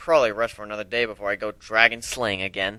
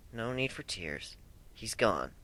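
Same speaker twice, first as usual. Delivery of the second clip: gentle comfort; 3 semitones lower, 15 dB quieter, slower than usual. Acted. Comforting crying dragon.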